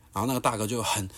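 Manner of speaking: normal talking voice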